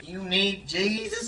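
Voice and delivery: low, slow, soft preacher; Billy Graham. Acted. Preacher stops them on the way, low and slow. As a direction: draw it out low and hushed. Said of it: "Billy Graham stopped them and said".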